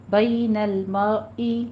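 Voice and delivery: بین drawn out very slowly, heavy را, no عی sound; slow recitation demo. Shown as a demonstration. Teacher models for the class, very slow recitation. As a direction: draw out بین المرئی slowly and deliberately. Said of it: "اس کو عی بھی نہیں کہنا ہے".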